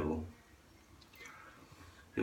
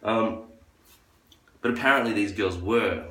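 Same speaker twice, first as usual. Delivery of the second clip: usual speaking voice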